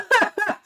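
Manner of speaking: a short, high burst of real laughter; sudden laugh. Real, not acted. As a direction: laugh out loud at yourself. Laughs at own racism remark.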